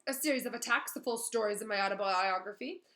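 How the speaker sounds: a touch of smug self-importance; boastful Lockhart. Acted. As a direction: brag about past heroics. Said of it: "said Lockhart".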